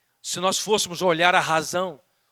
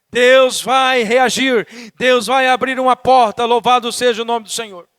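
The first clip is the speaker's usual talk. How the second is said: full-force preaching; 4 semitones higher, 8 dB louder than usual. Real, not acted. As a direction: declare it loud to the congregation. (pregação em voz forte)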